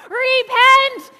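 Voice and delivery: big loud call of Repent; shouted Repent. Shown as a demonstration. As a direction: lead the crowd in a loud shout. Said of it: "Shout it with me".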